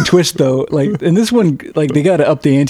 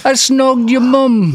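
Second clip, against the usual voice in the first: high, slow, cheeky mock British accent; taunting like a British schoolboy; 9 semitones higher, slower than usual. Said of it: "work the word snog".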